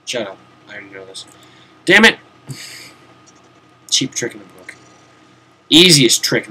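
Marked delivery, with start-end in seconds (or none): 1.8-2.2 loud, high curse at himself; frustrated outburst. Real, not acted. Gamer annoyed at himself, loud frustrated curse. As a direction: snap it out in annoyance.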